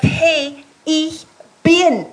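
as God, gently correcting the question; God speaking. Pretend.

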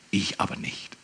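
soft unvoiced whisper; hushed whisper of God; whispered God reply. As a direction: whisper God's answer gently. God answering Moses, whispered.

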